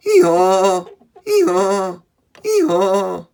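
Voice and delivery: loud, high ee-haw braying; donkey bray. Pretend. Showing kids the donkey's call, loud braying. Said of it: "The donkey says".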